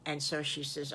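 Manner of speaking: usual speaking voice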